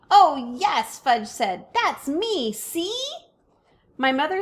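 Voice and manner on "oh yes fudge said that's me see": put-on high proud little kid; a small child showing off; toddler voice. A narrator's reading. Toddler Fudge insisting to his mother that he is on TV, high and proud.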